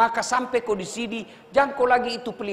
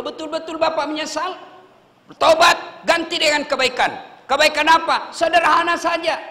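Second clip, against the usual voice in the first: louder, higher exhortation; loud preaching; 6 semitones higher, 5 dB louder than usual. Real, not acted. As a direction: urge the inmates to repent. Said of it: "preaching peak". (seruan lantang)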